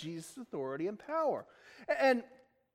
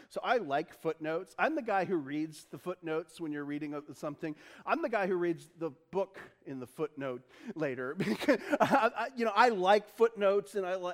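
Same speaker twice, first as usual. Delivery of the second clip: normal talking voice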